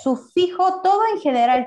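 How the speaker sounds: Sufijo called out high and loud; emphatic term. Shown as a demonstration. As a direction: hammer home the word Sufijo. Teacher stressing the key term.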